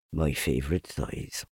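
low, soft, resigned; sad self-talk. A narrator's reading. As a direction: trail into quiet, mournful self-talk. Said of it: "he said to himself, sadly".

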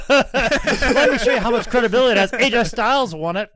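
high, loud, laughing taunt; loud mockery. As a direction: tease at full volume. Hammed-up mockery of co-host.

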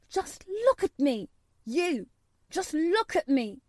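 high, insistent, defiant cry; flung out. A narrator's reading. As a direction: hurl it upward, demanding to be seen. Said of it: "he flung up at Ben".

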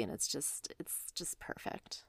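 breathy, hushed whisper of delight; dreamy whisper. Real, not acted. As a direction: sigh it out like a treat. Dreamy praise of girl chips.

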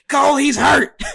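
loud, high-pitched imitation of an excited commentator; Booker T. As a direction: shout it like an alarmed ringside announcer. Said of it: "And then Booker T's like".

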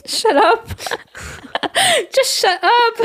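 high laughing "shut up". Real, not acted. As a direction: laugh through it in disbelief. Laughing at mother.

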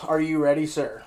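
ordinary talk

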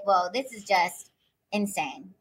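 half to herself in disbelief; stunned aside. Retold or imitated. She reacts to the masked man, stunned and quiet. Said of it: "And I was like".

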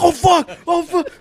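high, loud, frantic curse; panicked cursing. Retold or imitated. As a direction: gasp it out in a scramble. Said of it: "He's like".

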